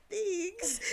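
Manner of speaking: high, drawn-out "Thanks"; mock-polite thanks. Woman re-enacts her reply to the stranger, high and awkward. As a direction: re-enact an uneasy, squeaky thanks. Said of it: "I was like this when he said".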